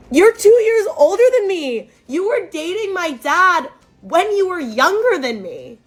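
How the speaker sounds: high-pitched acted protest; indignant outburst. Daughter confronting dad's girlfriend about her age, indignant outburst. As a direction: protest in outrage, pitch well up.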